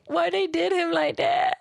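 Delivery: high, tearful wail of pity; half-joking, choked-up lament; crying voice. Real, not acted. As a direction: mourn the little dinosaur, close to tears. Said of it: "I could literally cry".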